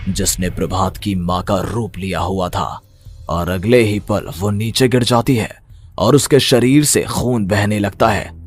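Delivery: usual speaking voice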